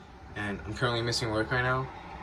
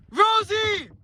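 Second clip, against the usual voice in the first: loud call to test the echo; shouting; 4 dB louder than usual. Real, not acted. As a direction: yell the name out across the water. Yelling to hear the echo.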